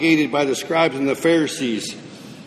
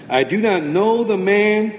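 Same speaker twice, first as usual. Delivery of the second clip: cursing and swearing; 6 semitones higher than usual. Retold or imitated. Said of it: "Then he began to curse and swear".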